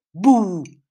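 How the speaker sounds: sudden loud "boo!"; one big loud burst to make someone jump; startling boo. Acted. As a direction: jump out and scare them. Sneaking up to startle mother.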